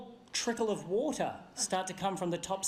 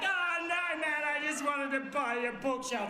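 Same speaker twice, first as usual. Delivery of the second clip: high-pitched panicky protest; mock panicked pleading; 3 semitones higher than usual. Buyer pleading with hammer-wielding seller, panicked. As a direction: plead your way out in comic alarm.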